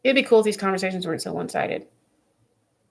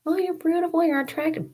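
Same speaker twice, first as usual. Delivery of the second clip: high, gushing mock flattery; sing-song fake compliment; 11 semitones higher than usual. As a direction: coo it like empty flattery. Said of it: "you can tell me".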